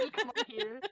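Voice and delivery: put-on high, giggling kid voice; laughing girl voice. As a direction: giggle through a childish claim. Voicing the ghost girl, laughing.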